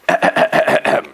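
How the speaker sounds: showy, repeated throat clearing. Acted. Storyteller about to read the book, theatrical throat clearing. Preparing to start reading aloud.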